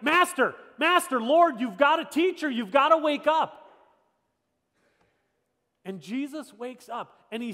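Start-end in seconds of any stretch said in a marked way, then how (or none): 0.0-3.7 loud, high, urgent cries; panicked disciples. Acted. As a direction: cry out in alarm to wake him. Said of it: "they're shaking. They're like".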